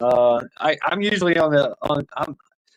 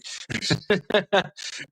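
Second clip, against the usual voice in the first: higher, rapid run of chuckles; giggling laugh; 6 semitones higher, 3 dB quieter than usual. Real, not acted. Host joking about troublesome vehicles, giggling laugh.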